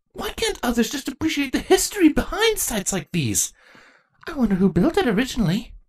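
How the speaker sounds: put-on eager, nerdy young girl voice; gushing about history; bookish young changeling. Chrysalis's voice.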